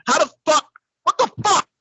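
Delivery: high-pitched baffled outburst; climbing, squeaky incredulity; exasperated disbelief. Real, not acted. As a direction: pitch it right up, totally baffled. Exasperated rant at the rapper.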